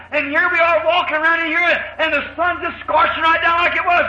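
high-pitched insistent complaint; protesting daughter-in-law. Acted.

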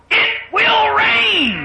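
high, loud, long-drawn proclamation; thundering conviction. Acted. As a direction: cut in with a slow, ringing shout. Noah cuts off daughter-in-law.

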